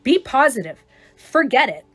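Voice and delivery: loud, bright forced cheerleading; pushy positive cheer. Acted. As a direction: push them to cheer up, loudly. Acting pushy positive friend.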